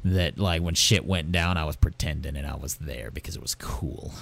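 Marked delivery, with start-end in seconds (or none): 1.6-4.1 hushed whispered aside; whispered confession. Real, not acted. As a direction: drop to a whisper as if sharing a secret. Confiding aside about childhood pretending.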